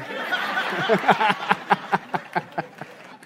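breathless unvoiced laugh. Real, not acted. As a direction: wheeze with laughter. Laughs at own joke.

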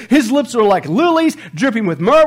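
loud, high declamation; hammed-up rapturous verse; impassioned scripture reading. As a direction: recite the love poem with swooning gusto. Acts out the woman's love poem.

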